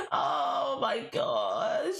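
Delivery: slow, drawn-out embarrassed groan; mortified wail. Real, not acted. Streamer mortified after the Southern accent, long embarrassed wail. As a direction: stretch it out in cringing disbelief.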